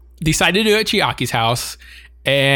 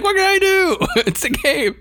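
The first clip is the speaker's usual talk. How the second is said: very high, laughing helpless shrug; innocent excuse. Retold or imitated. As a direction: play the innocent, giggling. Character excusing himself, retold.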